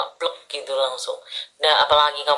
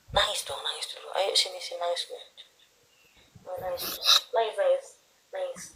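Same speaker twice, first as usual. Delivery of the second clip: much softer, gently urging her to cry; soft coaxing; 2 semitones higher, 9 dB quieter than usual. Real, not acted. Reader softly coaxes the tearful client to let herself cry. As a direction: hush and comfort a crying friend.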